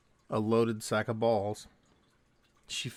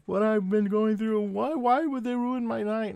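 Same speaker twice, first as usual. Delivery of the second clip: put-on high, loud, slow drunken wail; drawn-out mock drunk lament; 11 semitones higher, 5 dB louder than usual. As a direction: imitate a drunk woman wailing in protest. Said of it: "that drunk".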